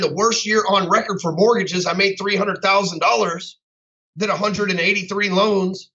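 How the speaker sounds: ordinary talk, nothing marked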